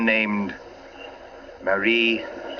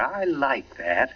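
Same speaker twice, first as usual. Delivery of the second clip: tipsy; 3 semitones higher, much slower than usual. Acted. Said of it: "Oh, you're tight".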